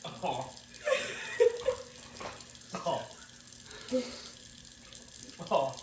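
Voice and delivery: sharp breaths out through the mouth; huffing from spice. Real, not acted. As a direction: blow out the heat in short huffs. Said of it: "It's still hot".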